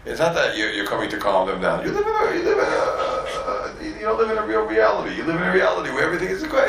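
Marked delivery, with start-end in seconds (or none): 1.8-6.7 rising preaching. Real, not acted. Preaching climax, shouted high.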